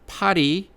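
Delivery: 파리 with a quick tapped Korean R; Korean flap R. Shown as a demonstration. Teacher contrasting 파리 with 팔, tapped flap. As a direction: flick the tongue once for 파리. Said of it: "the real sound of".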